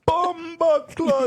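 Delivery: high, loud, drawn-out Jamaican exclamation; Jamaican accent. Retold or imitated. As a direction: slowly belt out a mock Jamaican curse. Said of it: "the Jamaican guy".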